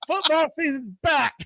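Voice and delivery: high, hyped cheer; excited. Real, not acted. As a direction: announce it like a jubilant fan. Celebrating football's return.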